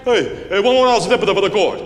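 high, loud broken English; broken English interpreter. Acted. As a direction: bark it like a bad interpreter. Interpreter figure voiced.

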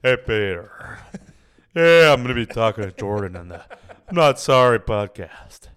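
loud, emphatic cartoon-neighbour voice; Joe Swanson. Shown as a demonstration. Host greets Peter and plugs podcast, Joe Swanson impression. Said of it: "the Joe Swanson voice".

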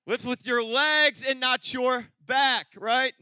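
loud, slow coaching call; loud slow lifting instruction; instructing loudly. Shown as a demonstration. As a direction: spell it out loud and slow like a trainer. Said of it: "the proper lifting".

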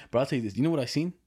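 normal talking voice

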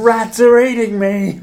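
high, loud, slow hammed-up cry; mock death shout. Joking about shouted film deaths, high mock shout. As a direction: overplay a dying victim. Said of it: "you'd start going".

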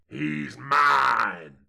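restrained, long-held bellow; villain's bellow. Acted. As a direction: stretch out a menacing claim. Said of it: "bellowed Evil Eyes".

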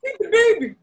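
high, loud command; mock urgent body. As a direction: bark it like a hungry instinct. Voicing the leaking body.

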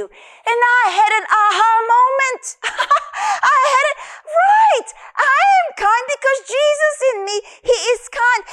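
overwhelmed with joy, voice breaking; real joyful crying; tearful joy. Real, not acted. Joyful breakthrough moment retold.

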